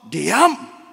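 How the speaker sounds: loud commanding yell of one word; sharp shout. Retold or imitated. Father shouts at his struggling son to be still, loud. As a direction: bark it to stop the struggling. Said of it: "Saya teriak lebih keras".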